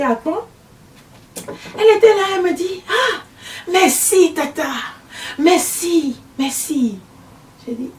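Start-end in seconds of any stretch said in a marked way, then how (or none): 2.9-7.0 high, slow, effusive thanks; gushing thanks. Retold or imitated. Woman gushing thanks to the aunt, high and slow. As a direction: thank her over and over, high-pitched.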